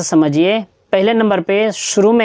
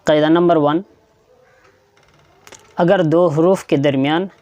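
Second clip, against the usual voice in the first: ordinary talk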